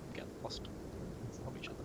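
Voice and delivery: whispering. Real, not acted. Quiet aside in the fog.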